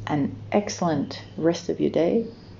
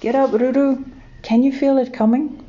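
high, loud, urgent calling voice; eager wake-up call; 9 semitones higher, 5 dB louder than usual. A narrator's reading. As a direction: rouse a sleeping friend, high and eager. Kiwi calling friends awake.

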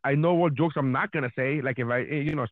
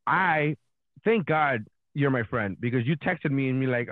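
ordinary delivery

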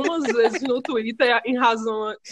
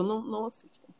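whispering; 14 dB quieter, much faster than usual. Real, not acted. Begging listeners not to watch.

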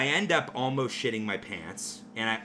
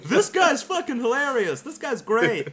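much higher, louder mock crowd voice; mocking onlookers; 13 semitones higher, 8 dB louder than usual. Neighbouring table praising the server, high and giddy. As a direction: squeal it like giddy fans.

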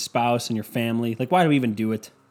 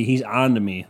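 ordinary delivery

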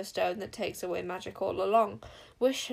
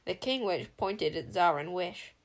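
ordinary delivery

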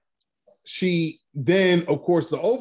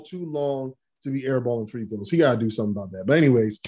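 ordinary talk

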